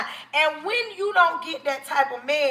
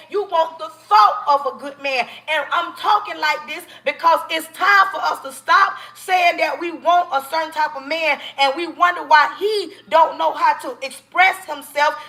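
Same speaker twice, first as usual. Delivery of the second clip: normal talking voice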